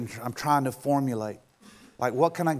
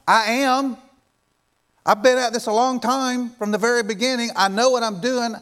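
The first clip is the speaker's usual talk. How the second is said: high, raised-voice declaration; forceful divine authority; 8 semitones higher, 5 dB louder than usual. Acted. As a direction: preach it as God putting doubters in their place. God's forceful answer to doubters.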